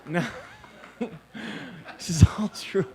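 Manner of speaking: breathless laughing whisper, slow; half-whispered through a laugh. Real, not acted. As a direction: still laughing, barely voiced. Laughing aside after the joke.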